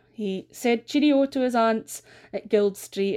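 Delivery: ordinary delivery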